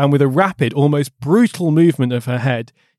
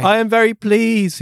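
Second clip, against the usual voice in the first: trembling voice; 7 semitones higher than usual. A narrator's reading. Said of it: "his voice trembled".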